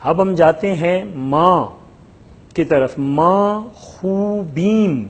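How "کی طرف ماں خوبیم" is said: ماں خوبیم drawn out, ماں stressed; slow Persian model. Shown as a demonstration. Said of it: "ہم تلفظ کر رہے ہیں". (ماں خوبیم کو کھینچ کر تلفظ)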